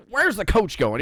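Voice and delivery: hammed-up J R calling the action; J R impression. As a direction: play a puzzled excited commentator. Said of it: "is like".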